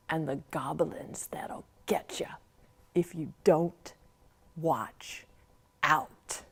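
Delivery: breathless hushed warning; whispered goblin warning; spooky whisper. Acted. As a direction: scare the children with the refrain. Spooky refrain of the poem.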